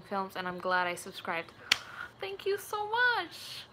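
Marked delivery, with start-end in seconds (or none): 2.2-3.7 high, drawn-out thank-you; gushing thanks. Real, not acted. Streamer thanks a longtime subscriber, high drawn-out gush. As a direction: gush it slowly, pitched way up.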